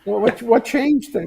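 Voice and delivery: high, drawn-out puzzled question; playful imagined incredulity; amused mock disbelief. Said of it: "had a laugh over a tequila".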